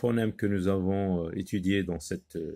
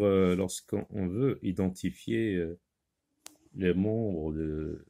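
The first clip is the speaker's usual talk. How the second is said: normal talking voice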